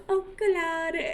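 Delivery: "Oculata" said as oh-koo-LAH-dah in an odd put-on voice; "Oculata" with a silly twist, oh-koo-LAH-dah; playful name. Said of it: "say her name like that forever".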